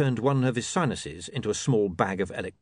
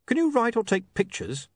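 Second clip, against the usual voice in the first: higher, pained polite question; wincing; 14 semitones higher than usual. A narrator's reading. Editor interviewing a hopeless applicant, wincing. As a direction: ask as if dreading the answer. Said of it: "said William, wincing".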